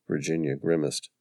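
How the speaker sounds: ordinary delivery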